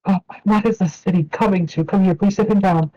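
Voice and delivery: high, alarmed diner owner; a middle-aged woman rushing up in alarm; worried wife. Acted. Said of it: "Sarifa continues".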